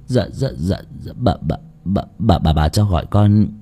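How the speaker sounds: low, soft, stuttering servant; frightened stammer. A narrator's reading. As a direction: stammer timidly before the angry mistress.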